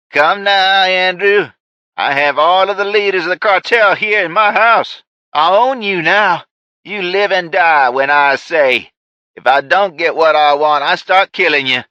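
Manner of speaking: high, loud villain ranting; unhinged shouting. Acted. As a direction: gloat and threaten at full volume. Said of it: "Judge shouted again".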